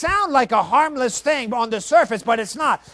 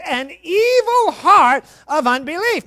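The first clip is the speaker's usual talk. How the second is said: preached emphasis; 7 semitones higher, 5 dB louder than usual. Real, not acted. Preacher stresses scripture.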